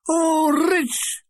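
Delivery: high, drawn-out beggar's cry; begging. A narrator's reading. Mulgrew claws the air like a beggar, begging wail. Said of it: "claws the air like a beggar".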